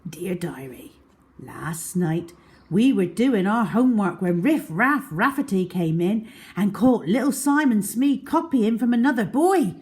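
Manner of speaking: usual speaking voice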